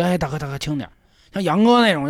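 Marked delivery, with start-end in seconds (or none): 0.0-0.9 soft wincing plea, half laughing; pleading softly. Retold or imitated. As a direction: beg him quietly to go easy. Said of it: "我就：".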